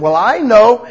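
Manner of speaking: loud, high defensive retort; defensive believer. Retold or imitated. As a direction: snap back like you know it all. Believer answering God defensively.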